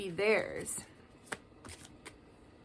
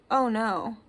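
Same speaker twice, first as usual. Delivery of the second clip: normal talking voice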